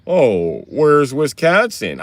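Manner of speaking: loud, drawn-out mocking question; mocked Southerner asking. Mimicking people from down south asking, mocking drawl. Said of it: "y'all don't even say it like that".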